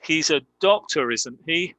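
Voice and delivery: tag "isn't he" lifts upward as a real question; rising intonation. Shown as a demonstration. Teacher demonstrating a real question to students, rising intonation on "isn't he". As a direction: make "isn't he" rise, genuinely asking. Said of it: "with rising intonation".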